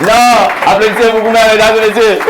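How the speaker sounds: loud, high call to applaud; rally the room; loud crowd call. Real, not acted. Comic urging the audience to applaud, loud.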